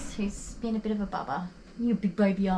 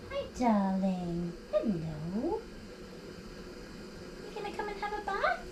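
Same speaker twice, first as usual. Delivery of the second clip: anxious doggy voice; 8 dB quieter than usual. Real, not acted. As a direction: coax a nervous dog gently. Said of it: "I talk in my anxious doggy voice".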